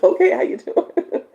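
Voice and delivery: high, loud, laughing hello; laughing greeting. Real, not acted. Host greeting a viewer while still laughing, high and loud.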